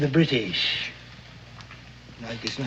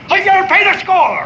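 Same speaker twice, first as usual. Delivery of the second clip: higher, louder mock outburst; mock anger; 6 dB louder than usual. Old sailor fakes a furious outburst at the boy over spilled rum. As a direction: put on an angry show for the soldiers. Feigned scolding for the soldiers.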